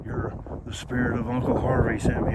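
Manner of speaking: normal talking voice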